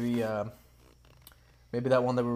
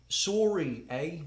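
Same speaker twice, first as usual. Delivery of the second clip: put-on high-pitched Canadian accent; polite mock-Canadian, pitched up; 5 semitones higher, much slower than usual. Player giving an accent clue, Canadian accent. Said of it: "Oh, Canadian".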